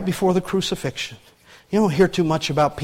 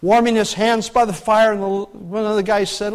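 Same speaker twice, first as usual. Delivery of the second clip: normal talking voice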